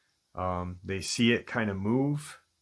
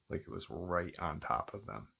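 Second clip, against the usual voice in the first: usual speaking voice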